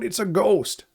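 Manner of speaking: high, scared shout of fear; frightened cry. A narrator's reading. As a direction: cry out in terror at a ghost. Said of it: "In fear, they cried out".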